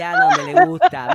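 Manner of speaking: high, loud teasing cry; excited shout. Real, not acted. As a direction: yell it out to tease. Teasing repeat, much louder. (grito burlón y fuerte)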